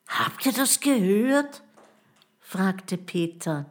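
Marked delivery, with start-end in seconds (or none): 0.1-1.6 high, startled question. A narrator's reading. Boy asks his friends about a mysterious cry, startled. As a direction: ask it in a higher, alerted voice.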